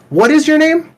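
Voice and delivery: high, loud exasperated demand; a dad demanding the worker's name, exasperated. Retold or imitated. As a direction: finally snap back at him. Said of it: "finally, I'm like".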